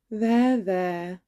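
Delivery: usual speaking voice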